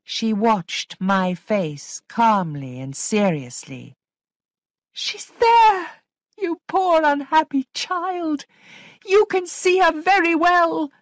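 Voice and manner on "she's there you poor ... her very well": very high, loud accusing cry; frantic insistence. A narrator's reading. Governess insisting poor Flora can see the ghost, frantic cry. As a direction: insist desperately, voice pitched right up.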